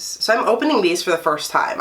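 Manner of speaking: ordinary talk, nothing marked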